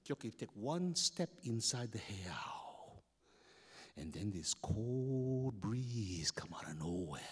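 slow hushed whisper; spooky whisper. A narrator's reading. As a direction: draw them in with a whisper. Tense moment inside temple.

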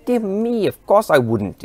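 high, loud burst of protest; exclaiming. A narrator's reading. Doctor Who reassures ashamed Jack, exclaiming loudly. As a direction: cry out in earnest protest. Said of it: "exclaimed Doctor Who".

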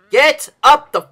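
high, loud exasperated bark; angry yell. Real, not acted. Gamer ordering his character up the rock, angry yell.

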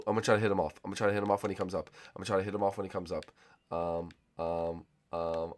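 ordinary talk

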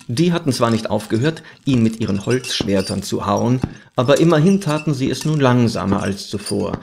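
usual speaking voice

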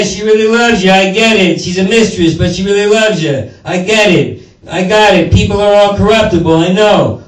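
mock-cordial greeting, full of sarcasm; sweet on top, poison beneath; fake friendly. President greeting donor Bob with mock cordiality. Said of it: "I gotta say hi to him".

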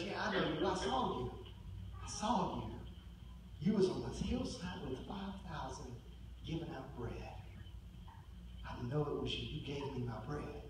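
lightly acted little girl, hushed and certain; quiet accusation. Little girl quietly identifies Peter.

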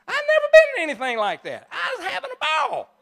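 high, fast, loud outburst; excited rush, voice way up; exuberant. Real, not acted. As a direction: burst out with sheer delight. Exuberant about the luxury suite.

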